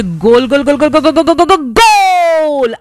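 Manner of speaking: rapid-fire repeats building into a long, high goal cry; football goal commentary. Acted. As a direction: call the goal like an excited commentator. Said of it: "just উচ্চারণটা কি হবে".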